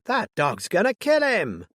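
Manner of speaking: high, loud frightened yell; frantic screaming woman; panicked scream. Acted. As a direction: shriek the warning across the churchyard. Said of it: "screamed a woman".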